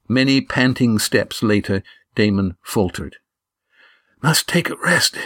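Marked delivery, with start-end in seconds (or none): 4.2-5.2 higher, out-of-breath gasp. A narrator's reading. Damon faltering under the stretcher, gasped. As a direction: gasp it out, worn out from carrying. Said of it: "he gasped".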